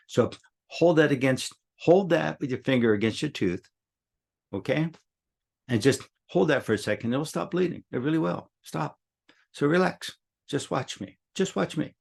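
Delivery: put-on gently steady the little girl; soothing a child. Calming the hurt child.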